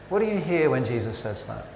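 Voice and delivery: ordinary talk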